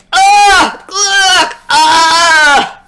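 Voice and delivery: hammed-up monster disgust; disgusted fart. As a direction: recoil from the stench. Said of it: "And it said".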